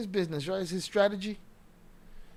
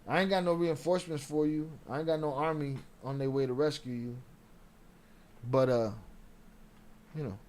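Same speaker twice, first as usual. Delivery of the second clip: usual speaking voice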